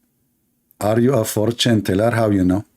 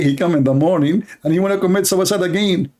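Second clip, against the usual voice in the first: normal talking voice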